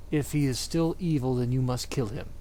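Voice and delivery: ordinary talk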